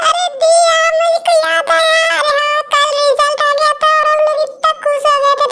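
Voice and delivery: suddenly remembering the good news; excited remembering. Acted. Girl recalls her result, excited and high.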